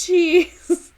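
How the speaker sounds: ordinary delivery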